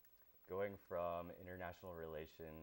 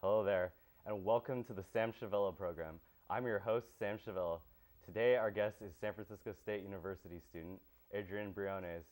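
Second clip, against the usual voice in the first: bright announcer welcome, a bit louder at the start; radio host intro; 9 dB louder than usual. Acted. Host welcoming listeners and guest, bright announcer voice. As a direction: open the show like a talk-radio host. Opens his interview show.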